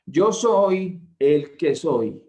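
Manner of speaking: "Yo soy el que soy" slow and loud, stress on "Yo"; emphatic slow quote. Shown as a demonstration. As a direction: spell out "Yo soy el que soy" slowly, leaning on "Yo". Preacher emphasizes God's name slowly. ("Yo soy el que soy" lento y enfático)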